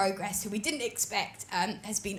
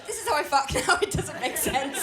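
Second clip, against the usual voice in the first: ordinary talk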